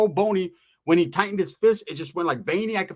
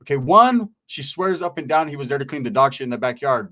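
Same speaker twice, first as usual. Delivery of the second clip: ordinary talk, nothing marked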